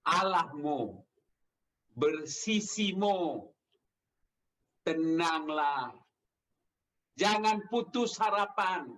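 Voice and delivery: very slow, weighty phrases with long pauses; solemn reassurance. Retold or imitated. Preacher speaks as Jesus to people under threat, solemn reassurance. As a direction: draw out each phrase to steady the fearful.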